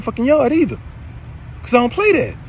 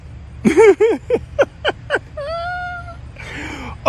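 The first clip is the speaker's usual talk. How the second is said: high laugh. Real, not acted. Laughing over her theft.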